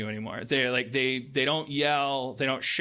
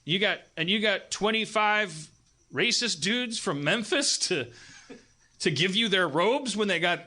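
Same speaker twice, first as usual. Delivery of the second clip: raised-pitch mock disbelief; incredulous questioning; 6 semitones higher than usual. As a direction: question him as if baffled, voice climbing. Acting out the bewildered activists.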